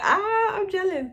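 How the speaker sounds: jealous squeal. Retold or imitated. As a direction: squeal it in playful envy, way up high. Mock squeal of envy at dress.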